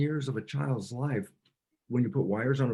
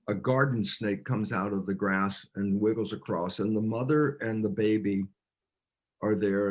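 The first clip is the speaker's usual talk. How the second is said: ordinary delivery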